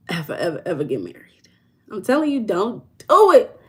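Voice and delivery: normal talking voice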